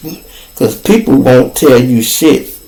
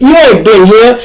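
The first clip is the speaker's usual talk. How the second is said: heated retold comeback; 6 semitones higher, 4 dB louder than usual. Man arguing with the realty worker, firm and loud. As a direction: hammer it home again. Arguing over who was there.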